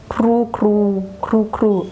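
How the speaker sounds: cooing like a pigeon. Retold or imitated. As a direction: coo it like a pigeon. Imitating pigeon sound.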